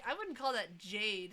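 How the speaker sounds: normal talking voice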